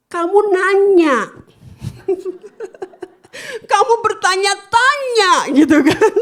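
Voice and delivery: high, loud teasing through laughter; laughing mockery; mocking laugh. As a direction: tease her while laughing. Mocking the caller.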